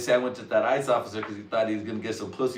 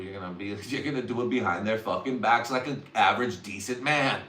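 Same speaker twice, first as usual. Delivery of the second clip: ordinary talk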